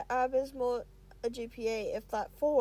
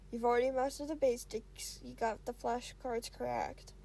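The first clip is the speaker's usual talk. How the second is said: ordinary delivery, nothing marked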